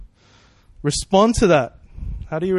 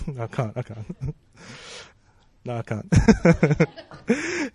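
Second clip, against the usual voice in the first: real laughter through the words; laughing; slower than usual. Real, not acted. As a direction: crack up while begging off. Laughs at own accent idea.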